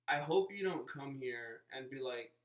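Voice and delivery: ordinary talk, nothing marked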